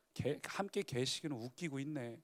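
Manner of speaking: soft, low sarcastic grumble under the breath; muttered to himself. Acted. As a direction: scoff quietly as if no one hears. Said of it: "혼잣말처럼". (혼잣말로 비꼬듯 작게 중얼거림)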